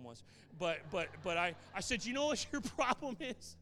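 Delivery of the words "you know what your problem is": high, loud, breaking into a laugh; loud repeat with a laugh in the middle; laughing challenge. Retold or imitated.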